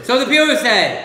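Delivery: slow, high, loud proclamation. Shown as a demonstration. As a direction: declaim it grandly and slowly. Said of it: "Or so the pure say".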